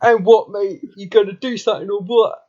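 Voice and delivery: playful "want a fight?" bluster; mock aggressive lad. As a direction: square up like a pub hard man, in jest. Mock British hard man squaring up.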